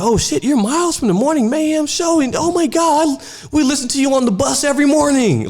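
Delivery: put-on high, excited kid fan voice; voicing an eager little kid, pitched high. Kid fan gushing to a radio host, high and excited.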